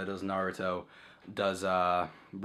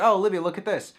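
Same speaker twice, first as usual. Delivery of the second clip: excited call; 10 semitones higher, 8 dB louder than usual. Retold or imitated. Showing girlfriend the game excitedly.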